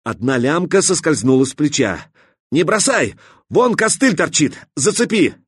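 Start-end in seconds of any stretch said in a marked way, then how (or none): 2.5-5.4 urgent shouting. Acted. Katya shouts to hook the container on the spike. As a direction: shout quick orders at him.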